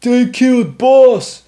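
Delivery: high, slow, loud brute voice; orc voice. Acted. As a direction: drag it out like a dim orc brute. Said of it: "you said it in the orc voice".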